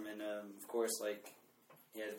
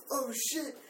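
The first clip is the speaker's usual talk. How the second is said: startled yelp; 13 semitones higher than usual. Retold or imitated. Friend startled by fireworks.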